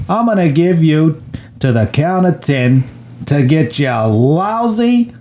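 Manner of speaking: drawn-out, hammed-up gangster menace; Johnny. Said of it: "Johnny says".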